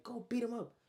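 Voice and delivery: high-pitched urging; egging him on. Retold or imitated. Trey to his friend mid-fight, egging him on. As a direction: imitate a friend goading the fighter. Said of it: "while Trey egging him on".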